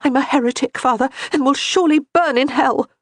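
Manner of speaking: put-on a troubled girl confessing; young woman's voice. A narrator's reading. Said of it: "Johanna confesses to the priest".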